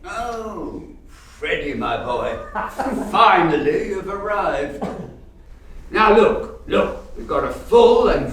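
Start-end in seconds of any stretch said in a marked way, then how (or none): 2.5-3.1 put-on a short, pleased old-man chuckle; gleeful chuckle. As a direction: chuckle with delight at his arrival. Scrooge greets Freddy gleefully.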